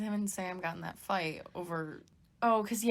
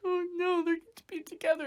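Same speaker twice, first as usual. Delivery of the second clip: high, rushed mock whimper; acted tearful reaction; 10 semitones higher, faster than usual. Viewer moved at Mary's grave, acted tearful whimper. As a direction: whimper it fast, as if about to cry. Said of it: "could have bursted out in tears".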